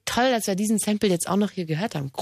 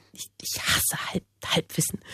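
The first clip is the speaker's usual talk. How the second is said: whispering; 4 semitones lower, 6 dB quieter than usual. Real, not acted. Muttered aside about half-knowledge.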